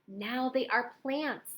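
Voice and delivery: usual speaking voice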